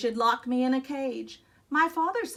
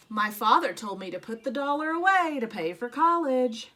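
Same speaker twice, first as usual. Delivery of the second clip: ordinary delivery, nothing marked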